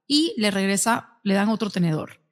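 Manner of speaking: normal talking voice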